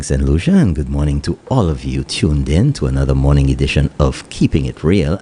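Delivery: normal talking voice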